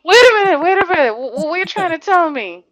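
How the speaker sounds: shocked, loud wait a minute; mock outrage. Real, not acted. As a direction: jump up in playful disbelief. Mock outrage at friend's answer.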